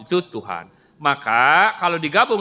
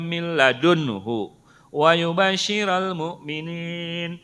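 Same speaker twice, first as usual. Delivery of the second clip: chanted Quranic recitation, slowing at the end; 3 semitones higher, slower than usual. Shown as a demonstration. Teacher reciting Al-Kahfi as an example, chanted. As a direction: recite the verse in tajwid chant.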